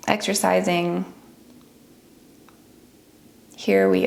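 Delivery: unhurried meditation voice with long pauses; guided meditation. Acted. Teacher talks listeners into relaxing each body part, guided meditation. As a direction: ease them gently through a body scan, leaving space between phrases.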